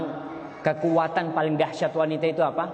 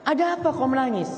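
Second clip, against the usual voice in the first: high, concerned mother's voice; worried parent asking; 11 semitones higher than usual. Retold or imitated. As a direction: ask like an anxious mother. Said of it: "bingung ibu sama bapaknya". (ibu yang cemas bertanya dengan suara tinggi)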